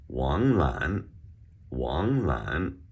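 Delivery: "Wang Lan" with two big swooping rises; exaggerated tones. Shown as a demonstration. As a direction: sing out both rising tones of "Wang Lan". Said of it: "we have a couple more names here".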